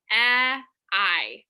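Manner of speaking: vowel sounds of advise; isolated vowel sounds. Shown as a demonstration. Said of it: "so if you can hear it".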